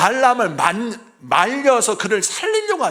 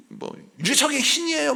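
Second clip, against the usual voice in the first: put-on cheeky child; 8 semitones higher than usual. Mocking child's retort.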